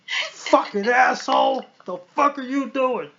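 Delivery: hammed-up road rage shout; mock angry driver yell. Drivers yelling at him.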